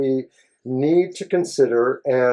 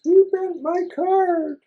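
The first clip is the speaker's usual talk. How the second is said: mock accusation; slower than usual. Playful accusation over bent card.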